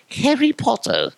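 hammed-up Harry Potter voice; put-on wizard-film voice. Comic reminded of Harry Potter, hammed-up character voice. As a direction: slip into a mock Potter voice.

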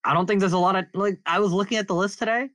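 words pushed through clenched teeth; talking through teeth. Real, not acted. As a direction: speak without opening the jaw. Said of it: "I'm talking through my teeth right now".

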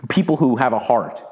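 ordinary delivery

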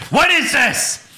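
high, loud outburst of disbelief; incredulous yell. Acted. Co-host reacting to the segment, appalled yell.

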